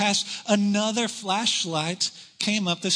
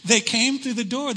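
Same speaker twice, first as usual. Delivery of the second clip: ordinary talk, nothing marked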